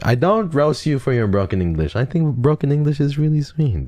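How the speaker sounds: high-pitched, cooing reassurance; gushing reassurance. Real, not acted. Streamer reassuring viewer about broken English, high gushing. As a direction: reassure them in a high, sweet squeal.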